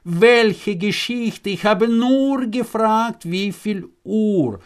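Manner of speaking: high, drawn-out bewilderment; puzzled young man protesting his innocence; baffled wonder. Acted. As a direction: stretch the questions out high in disbelief. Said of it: "wunderte sich der Junge".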